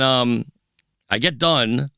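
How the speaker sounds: ordinary delivery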